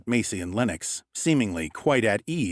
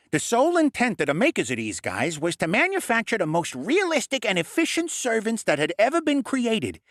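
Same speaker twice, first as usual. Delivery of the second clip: higher, brisk lecturing goblin voice; know-it-all goblin; 9 semitones higher than usual. A narrator's reading. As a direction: explain like a smug little expert. Goblin Lennox explains.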